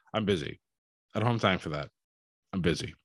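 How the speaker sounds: deadpan, low and soft brush-off; straight-faced. Retold or imitated. Boss brushing off an excited wrestler, straight-faced. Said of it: "just straight-faced".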